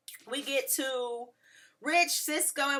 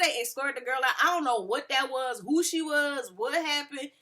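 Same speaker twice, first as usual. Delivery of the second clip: ordinary delivery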